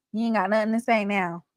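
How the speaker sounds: usual speaking voice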